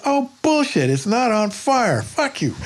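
high scoffing dismissal; scoffing waterman. Retold or imitated. Willie scoffing at the messenger, high scornful voice. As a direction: wave it off with scorn. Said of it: "And he goes".

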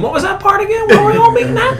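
very high, airy mock innocent voice, laughing through it; clueless kid voice. Friend retelling a comedy bit mimics a student playing dumb in a squeaky high voice. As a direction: play the oblivious kid asking for details in a squeaky voice. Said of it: "he's sitting there going".

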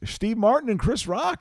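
excited high announcement; announcing. Real, not acted. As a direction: call out the names like an emcee.

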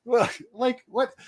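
high-pitched, incredulous mock scoff; imitated scoffing. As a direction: scoff in disbelief, imitating an opponent. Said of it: "like, scoffing".

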